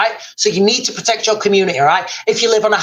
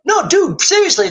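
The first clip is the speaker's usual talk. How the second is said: pitched up high, insisting; insistent exclaim; 8 semitones higher than usual. Retold or imitated. Telling Joe Rogan it's true, high insistent exclaim. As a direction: push it up high like you can't believe it.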